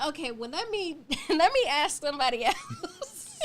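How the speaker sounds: high-pitched, laughing through the words; laughing mock brush-off. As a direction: brush them off jokingly, cracking up. Acted reply to a lukewarm friend.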